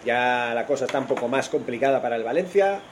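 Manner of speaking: normal talking voice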